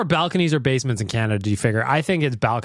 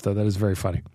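ordinary delivery

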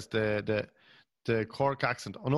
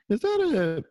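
much higher, sing-song fake innocence; mock-innocent wife; 7 semitones higher than usual. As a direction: lure Julie to the toaster sweetly. Mock-innocent murder plot questions.

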